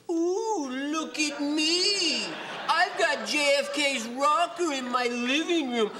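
high, laughing smug buyer; the man. Acted. Auctioneer mocks the buyer, high and smug. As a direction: gloat like a proud rich buyer. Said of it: "so the man can say".

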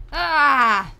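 sudden loud yelp; startled shout. Real, not acted. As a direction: yelp in surprise. Sudden scare in game.

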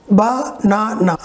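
Banana slowly broken, ba, na, na; syllable by syllable. Shown as a demonstration. Teacher breaking the word for students, slow separate syllables. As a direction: split Banana into three even beats. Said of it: "how you can break".

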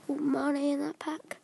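usual speaking voice

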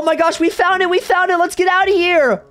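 ordinary talk

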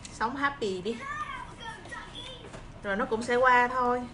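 ordinary talk, nothing marked

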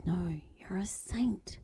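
soft, slow whisper of reassurance; hushed reassuring whisper; gentle whisper. Acted. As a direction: whisper it like God's tender voice. God's voice whispered.

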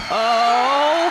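startled yell; scared shout. Real, not acted. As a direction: yell out in fright. Scared shout in horror game.

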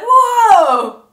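loud, high cry of shock; shocked whoa. Retold or imitated. Retelling a reaction to the scene's twist, shocked shout.

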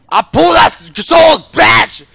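loud, high gamer yell; fierce shout. Real, not acted. Gamer boasting of a soul pull, fierce shout. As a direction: yell it at the opponent. Triumph in fight.

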